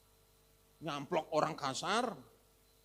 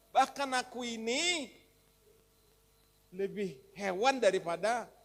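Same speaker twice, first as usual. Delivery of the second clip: high, loud pleading questions; anguished questioning; 6 semitones higher, 5 dB louder than usual. Acted. Preacher voicing Agur asking God why he is worn out. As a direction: cry out why, then confess harshly.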